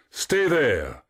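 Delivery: high, falling call. A narrator's reading. Narcissus calls as he runs, high shout. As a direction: call it back over distance. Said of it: "calling as he ran".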